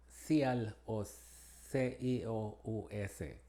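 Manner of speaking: ordinary delivery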